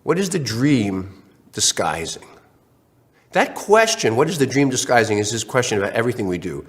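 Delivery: normal talking voice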